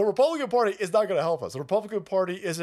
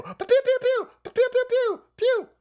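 very high, loud "pew" gunshots; rapid squealed laser-gun bursts; imitated gunfire; 7 dB louder than usual. Storyteller acting out truck shooting, high piercing pews.

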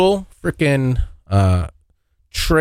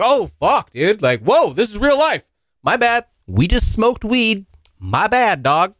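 high-pitched reenacted panic; stoned kid's alarmed apology, acted out; startled realisation; 8 semitones higher than usual. Acts out sudden realisation and apology.

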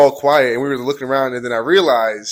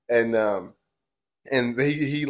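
ordinary talk, nothing marked

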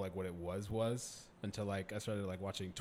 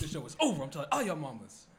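mock scolding; 5 semitones higher, 6 dB louder than usual. Mock scolding of the concert crowd.

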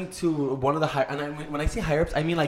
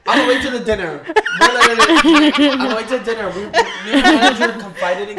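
loud, laughing retelling; laughing while talking; 6 semitones higher, 9 dB louder than usual. Real, not acted. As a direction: try to talk through real laughter. Cracking up while starting the story.

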